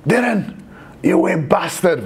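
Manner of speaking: high, slow Scottish jibe breaking into a laugh; mock Scottish. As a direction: ham up a Glasgow insult, laughing. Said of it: "the only one I've got is like".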